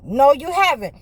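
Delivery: normal talking voice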